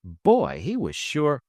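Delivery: ordinary delivery, nothing marked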